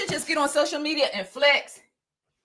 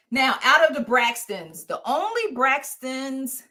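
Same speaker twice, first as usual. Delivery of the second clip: normal talking voice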